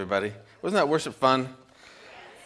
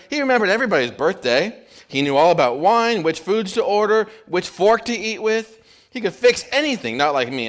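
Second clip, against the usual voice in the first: higher and louder, piling on the praise; gushing cabbie; 5 semitones higher, 4 dB louder than usual. Retold or imitated. Cabbie lists Frank's talents in a higher, louder gush. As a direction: keep gushing about Frank.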